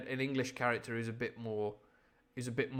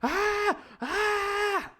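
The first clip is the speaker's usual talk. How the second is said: high, loud sustained screams; hammed-up piercing shrieks; mock death yell; 9 dB louder than usual. Shown as a demonstration. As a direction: scream twice in imitation of a distant cry. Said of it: "So all that sounded like".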